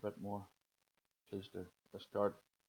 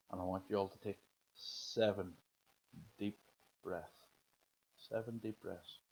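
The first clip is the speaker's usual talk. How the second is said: guided meditation. Acted. Leads a breathing meditation.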